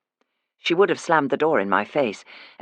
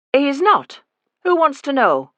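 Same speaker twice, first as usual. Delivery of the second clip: higher, sharp Yorkshire woman's voice; guard the door against a stranger; wary mother fends off the nurse, sharp and higher; suspicious Leeds woman; 11 semitones higher, 5 dB louder than usual. Acted.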